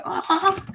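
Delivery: high, soft swooning squeal. Retold or imitated. Student recalling a lovely Newcastle accent, high swooning squeal. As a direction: coo "ah" high and small.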